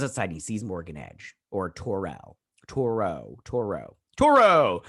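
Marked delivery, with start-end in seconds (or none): 4.2-4.8 high, loud burst on the name; sudden mock-heroic shout; playful loud call. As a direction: belt the name out for fun. Last repeat of name, called out.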